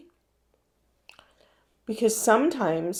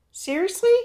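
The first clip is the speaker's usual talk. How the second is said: much higher and much louder, incredulous; shocked disbelief; 11 semitones higher, 5 dB louder than usual. Retold or imitated. Vicky balks at her husband's advice, loudly incredulous. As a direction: throw it back like it's absurd.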